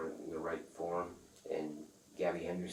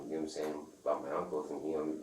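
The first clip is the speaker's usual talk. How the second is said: normal talking voice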